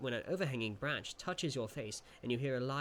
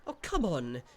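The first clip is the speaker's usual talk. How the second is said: higher, louder impatient sprite; impatient grumble; 5 semitones higher, 7 dB louder, slower than usual. Acted. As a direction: hurry them along, fed up. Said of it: "it grumbles impatiently".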